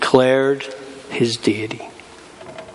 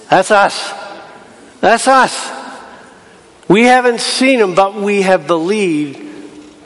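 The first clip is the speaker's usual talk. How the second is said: excited realization; 4 semitones higher, slower than usual. Real, not acted. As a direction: proclaim it with growing excitement. Preacher exclaiming to congregation.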